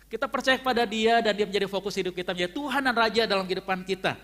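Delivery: sermon voice pushing the point home; fervent preaching. Acted. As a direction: preach it with full conviction, pressing them. Preacher exhorting the congregation.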